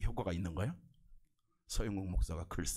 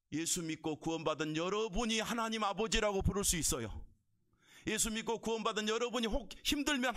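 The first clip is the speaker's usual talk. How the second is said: raised-pitch pulpit proclamation; forceful preaching; 11 semitones higher, 3 dB louder than usual. Real, not acted. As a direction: proclaim it earnestly to the believers.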